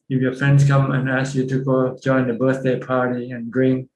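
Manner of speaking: ordinary talk, nothing marked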